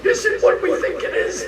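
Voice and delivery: high-pitched fretful questioning; anxious internet searcher. Acted. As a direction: fret aloud over search results. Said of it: "I'm on the internet and I'm looking up".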